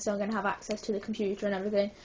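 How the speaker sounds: ordinary talk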